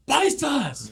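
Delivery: high-pitched mock holler; retold high yell; imitated call-out. Imitates a call across the tier.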